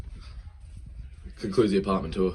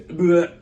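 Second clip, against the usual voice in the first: loud, higher vomiting noise; mock retch; 8 semitones higher, 7 dB louder than usual. Friend vomits as the bag is taken away, mock retch. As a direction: imitate a sudden spew.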